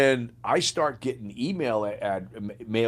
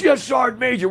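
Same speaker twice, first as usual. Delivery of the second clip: much higher, louder bark of a reply; soldier's reply; 14 semitones higher, 7 dB louder than usual. Retold or imitated. Sergeant shouting back at his sergeant major. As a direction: answer with full soldier fervour. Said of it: "He goes".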